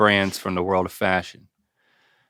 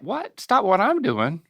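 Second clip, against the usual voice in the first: high mock incredulity; 9 semitones higher than usual. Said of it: "it's like".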